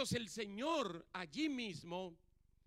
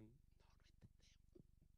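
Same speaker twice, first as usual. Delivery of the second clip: hushed, slow whisper; 10 semitones lower, 24 dB quieter, slower than usual. Retold or imitated. Disciples admitting to Jesus, in a slow whisper. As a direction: breathe it out like a sheepish confession. Disciples' sheepish reply retold.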